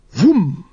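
drawn-out vroom; imitated whoosh. A narrator's reading. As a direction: voice a big slow whoosh. Imitating a blast of sword.

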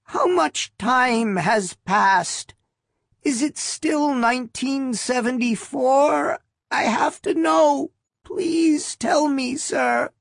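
put-on high, slow, pleading old lady voice; pleading old woman. A narrator's reading. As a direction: beg like a frail old woman.

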